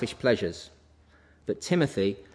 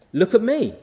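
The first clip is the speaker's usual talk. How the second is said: Paul's voice; 8 semitones higher, 4 dB louder than usual. Acted. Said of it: "That's what Paul says".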